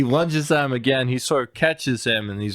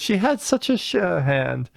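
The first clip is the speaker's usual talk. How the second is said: quoting Doolittle; 6 semitones higher than usual. Retold or imitated. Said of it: "First he says".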